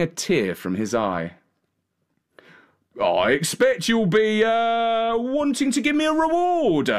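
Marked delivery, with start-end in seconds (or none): 3.0-6.9 high, wheedling coachman climbing in pitch; sly hint that climbs higher and higher. A narrator's reading. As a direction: angle for a reward, voice rising as you go. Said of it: "added the coachman".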